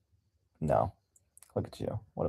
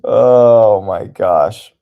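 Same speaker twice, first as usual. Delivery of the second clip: surprised exclaim; 2 semitones higher, 14 dB louder, much slower than usual. Real, not acted. Reacting loudly to poll votes.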